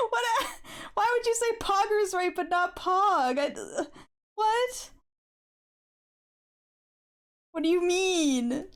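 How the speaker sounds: high-pitched, incredulous protest; baffled disbelief. Retold or imitated. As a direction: react in hammed-up bewilderment, pitch leaping up. Retells baffled reaction to the test.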